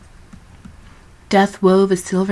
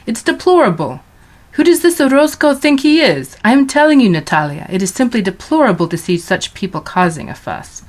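loud, high indignant father; father's outburst; 5 semitones higher, 5 dB louder than usual. A narrator's reading. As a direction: rant at the newspaper. Father rants over newspaper.

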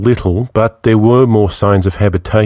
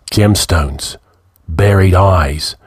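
usual speaking voice

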